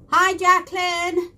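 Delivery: high bright hello to a viewer; calling out a happy hello; excited greeting. Real, not acted. Greeting viewer in live chat.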